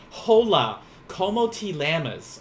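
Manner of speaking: loud, slow English-accented Spanish. Shown as a demonstration. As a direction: clumsily read Spanish with an obnoxious English accent. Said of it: "if I were to try to speak Spanish".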